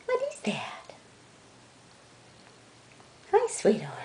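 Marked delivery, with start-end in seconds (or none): 0.1-0.9 whisper. Real, not acted. Whispering to the cat.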